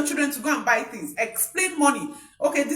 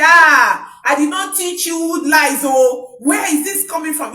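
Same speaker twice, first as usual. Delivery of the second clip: furious mother's outburst, loud; angry scolding shout; 5 semitones higher, 8 dB louder than usual. Acted. Mother shouts at her child for lying, angrily. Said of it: "I say".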